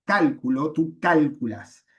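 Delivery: cálculo and cálculas stressed on CÁL, slowly; Latin-style stress. Shown as a demonstration. Teacher showing the Latin accent rule with stress on CÁL. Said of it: "por las reglas de acentuación latinas".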